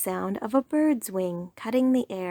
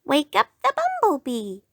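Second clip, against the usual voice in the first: squirrel voice; 6 semitones higher, 5 dB louder than usual. Acted. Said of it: "said the squirrel".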